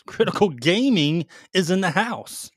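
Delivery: slow, loud hype-man call; hype announcer voice. Acted. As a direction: announce it like a show host. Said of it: "Why'd you say it like that?".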